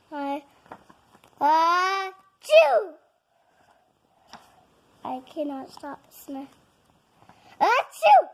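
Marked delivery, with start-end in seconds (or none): 1.4-2.9 big explosive sneeze; loud sneeze. Acted. 7.6-8.3 big explosive achoo; loud sneeze. Acted.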